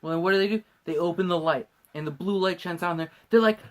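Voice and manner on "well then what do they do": usual speaking voice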